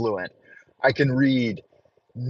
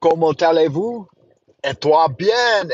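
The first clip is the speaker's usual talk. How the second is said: hammed-up French accent; French phrase; 3 semitones higher than usual. Shown as a demonstration. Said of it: "ask you how you are in French".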